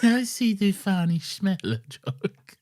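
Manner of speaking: put-on grandma in law greeting Lorenzo, Italian accent; Italian nonna. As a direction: play a doting Italian granny calling his name. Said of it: "an impression of Lawrence's nonna-in-law".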